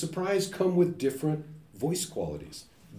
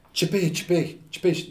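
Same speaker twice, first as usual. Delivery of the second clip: piranha swim sound; 3 semitones higher, much slower than usual. Retold or imitated. Lecturer imitating the fish sound. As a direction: mimic a piranha swimming by. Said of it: "that's the sound of a piranha swimming".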